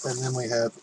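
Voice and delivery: normal talking voice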